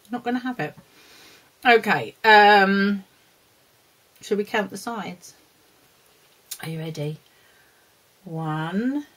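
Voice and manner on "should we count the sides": ordinary talk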